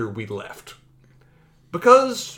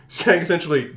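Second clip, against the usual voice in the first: ordinary talk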